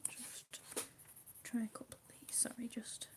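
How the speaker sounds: soft whisper over the colouring; hushed ASMR whisper. Acted. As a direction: whisper gently, as if not to break the calm. Whispered while sharpening.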